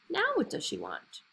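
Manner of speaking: high, light fish voice; a small high fish voice asking; fish's voice. A narrator's reading. Magic fish asks.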